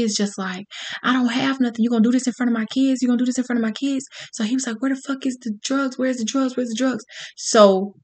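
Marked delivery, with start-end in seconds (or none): 1.0-4.0 higher, rushed mother's protest; quick, higher-pitched retold plea; imitated pleading mother. Mother pleading with gunman, acted. 4.8-7.0 higher, softer voiced demand; imitated gunman's demand. Gunman demanding drugs, acted.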